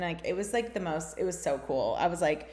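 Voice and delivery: ordinary talk, nothing marked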